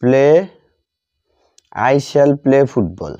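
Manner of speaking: ordinary delivery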